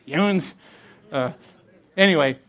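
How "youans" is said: "Youans" said as one word, YOO-unz, a touch higher; regional youans. Shown as a demonstration. Pastor repeats the Northeast word for his congregation, amused. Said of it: "youans is what they use up here in the Northeast".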